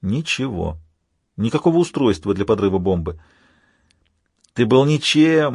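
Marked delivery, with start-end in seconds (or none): 4.5-5.5 high, slow villain's jeer; contemptuous villain goading the hero; taunting villain Alpha. Acted. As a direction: draw out the insult in a raised voice.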